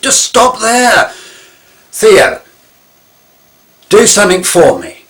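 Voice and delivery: slow, high, loud command to stop rambling; loud, deliberate order to stop talking. Retold or imitated. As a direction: halt him firmly, drawing out stop. Firmly halting rambling friend.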